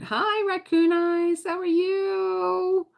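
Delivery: very high, drawn-out singsong hello; sing-song greeting. Real, not acted. Greeting a viewer in the chat.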